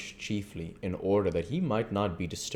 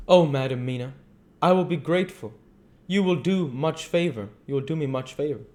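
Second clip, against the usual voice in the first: higher, louder voice for the earnest foreign doctor; earnest old doctor; 8 semitones higher, 6 dB louder, faster than usual. Acted. The doctor to Mina, explaining his visit, grave and courteous.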